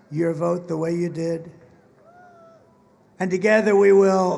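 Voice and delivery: rousing rally speech, building to a roar. Acted. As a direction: work the crowd, big and proud.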